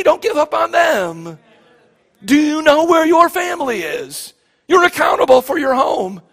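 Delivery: impassioned plea. Real, not acted. Preacher exhorting congregation.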